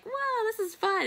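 squealing high with delight; a thrilled kid loving the bumpy ride; child on a shaky takeoff squealing with glee; excited little girl. Retold or imitated. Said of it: "screaming of excitement".

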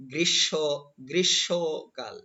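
গ্রীষ্মকাল slowly as "grish-sho-kal", no m; correct pronunciation. Shown as a demonstration. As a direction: break গ্রীষ্মকাল into slow syllables, doubled sh. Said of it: "এটি হবে".

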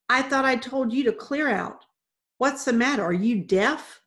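loud, irritated husband; angry scolding. Acted. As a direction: tell them off sharply.

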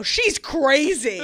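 loud, drawn-out retold outburst; loud mock exclamation. Telling the people next to her, loud mock disbelief. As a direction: announce it slowly and loudly, hamming it up. Tells startled neighbouring passengers about friend.

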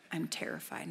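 low, hushed mock fear; nervous father admitting his fear, lightly voiced; terrified father. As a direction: let "terrified" sink, dropped low and soft. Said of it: "the one's really nervous".

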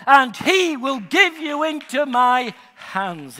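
loud defiant proclamation, slowing at the end; bold battle cry. Retold or imitated. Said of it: "he says".

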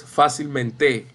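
fácilmen-TÉ, last syllable stressed, slow; wrong final stress. Shown as a demonstration. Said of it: "No es fácilmente".

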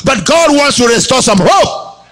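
high, ringing preacher's call to the congregation; full-force preaching. Acted. Preacher rousing the morning congregation, full-force preaching. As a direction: proclaim it like a revival preacher.

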